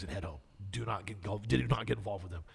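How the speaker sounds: low, soft voice giving a quiet warning; hushed warning. Retold or imitated. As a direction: lean in and murmur the advice. Contractor quietly warning him.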